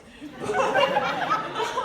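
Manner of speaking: high burst of laughter; loud laugh. Real, not acted. Laughing at herself.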